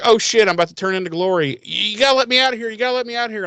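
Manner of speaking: high, fast, hammed-up panic; mock panic. Man about to turn into Glory begs friends, hammed-up panic. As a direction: rush it out like he's about to change. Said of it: "he's like".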